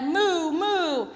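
loud high mooing; cow mooing. Acted. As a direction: moo like a cow. Cow noise.

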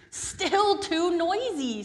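high, slow, whining complaint; drawn-out complaint. Acted. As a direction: stretch out the grumble. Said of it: "said Peter".

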